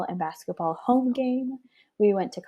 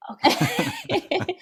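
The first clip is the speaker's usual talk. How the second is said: laughing okay; 12 semitones higher, 4 dB louder than usual. Retold or imitated. Agreeing with a laugh.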